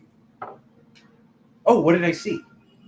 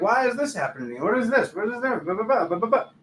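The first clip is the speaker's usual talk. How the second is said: higher, exasperated inner voice; mock whiny confusion; 6 semitones higher than usual. Viewer mocking their own confused inner voice, higher whine. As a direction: whine it like a confused, fed-up self.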